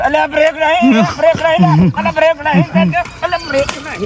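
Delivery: high, frantic cries to free his leg; panicked pleading. Acted. Stuck leg, begging his friend to pull it out in high panic.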